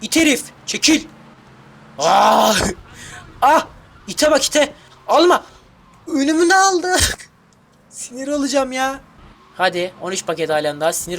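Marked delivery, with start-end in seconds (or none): 0.0-9.0 higher, louder furious yelling at the rival; angry shouting. Real, not acted. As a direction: scream at the car stealing your packages. Shouting at rival car stealing packages. (paketi kapan arabaya sinirle bağırış)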